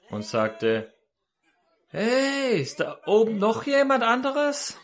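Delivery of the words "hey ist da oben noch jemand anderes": high, drawn-out comic call; calling upward. Retold or imitated. Hanging man seeks another rescuer after God's answer, high call. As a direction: call hopefully for somebody else up there.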